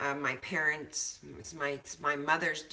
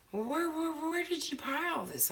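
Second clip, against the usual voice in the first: mock guest; 12 semitones higher than usual. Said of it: "they're like".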